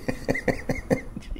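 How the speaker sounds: silent wheezing laugh; airy high wheeze of laughter; breathless laugh. Real, not acted. Comic cracking up at the resort's rules, breathless. Bursts out after clerk's answer.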